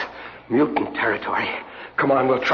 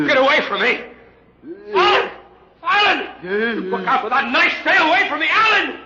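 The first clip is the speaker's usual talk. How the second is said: panicked yelling; 14 semitones higher than usual. Acted. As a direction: scream for your friend in panic. Attacked by mutants, calling friend.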